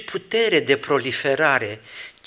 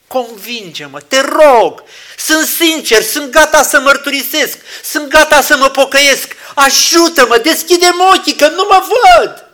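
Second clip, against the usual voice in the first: high, loud pleading prayer; a sinner begging God to open his eyes; fervent plea; 11 semitones higher, 13 dB louder than usual. Retold or imitated. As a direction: beg with everything.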